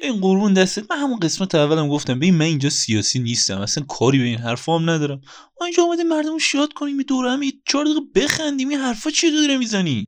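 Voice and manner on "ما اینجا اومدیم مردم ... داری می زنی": exasperated. Acted. Exasperated host protesting.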